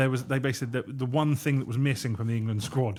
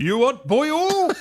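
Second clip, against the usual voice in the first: high, slow mock Welsh accent; hammed-up Welsh put-on, slowly; 12 semitones higher, 6 dB louder, slower than usual. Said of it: "Do that again".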